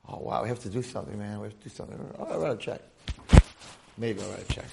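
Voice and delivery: low, soft, mumbled mock concern; half-hearted concern. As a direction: go through the motions, quietly and without energy. Imitating half-hearted community response.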